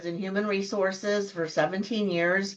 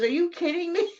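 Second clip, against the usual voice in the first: boss in disbelief; 11 semitones higher than usual. Retold or imitated. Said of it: "And she goes".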